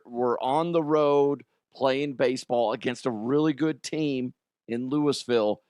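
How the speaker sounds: ordinary delivery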